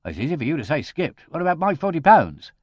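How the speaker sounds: indignant. A narrator's reading. Gardener complaining about his forty pounds, indignant. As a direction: demand your money back, offended. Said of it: "Crutchley was indignant".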